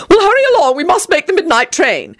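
fussy urging. Acted. Tony hurries the child along.

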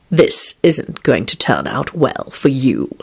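slow, low, icy warning; cold statement. A narrator's reading. Stranger from hallway to gunmen, cold. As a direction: say it coldly and unhurriedly. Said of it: "stated coldly".

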